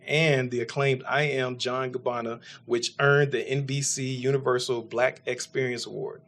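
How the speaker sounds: ordinary talk, nothing marked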